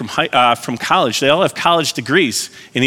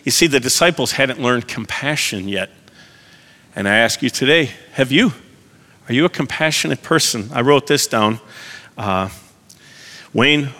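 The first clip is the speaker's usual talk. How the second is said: ordinary talk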